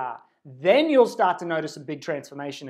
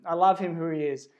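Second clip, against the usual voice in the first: normal talking voice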